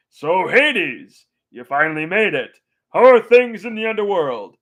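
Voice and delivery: booming cheerful greeting from a proud father; Zeus impression. Zeus greeting Hades at the party, hearty and high. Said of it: "so hilarious and so quotable".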